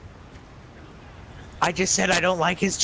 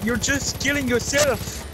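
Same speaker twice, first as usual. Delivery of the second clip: put-on higher-pitched accented warlord, begging for his life; pleading warlord commander; 6 semitones higher than usual. Warlord begs the attackers not to kill him, high and insistent. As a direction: play a self-important commander in a high accented voice. Plays enemy warlord in game.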